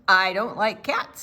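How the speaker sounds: the man refuses the cat, loud and gruff; grumpy man. Acted. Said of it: "said the man".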